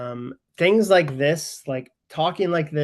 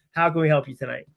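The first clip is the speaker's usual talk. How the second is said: usual speaking voice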